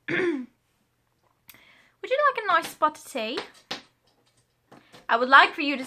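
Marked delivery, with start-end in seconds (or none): 0.1-3.4 hammed-up British accent, higher pitched. Shown as a demonstration. Playing up the British accent for viewers. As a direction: offer the tea like a posh Brit, in a British accent. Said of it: "a British accent".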